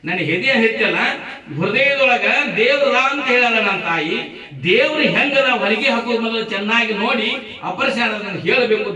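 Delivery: high, loud acted child's appeal; impassioned demand. Child asks surgeon to look for God, insistent.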